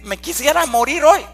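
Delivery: mildly dramatized outcry; mock lament. Acts out a wish to die.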